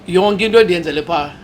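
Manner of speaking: ordinary talk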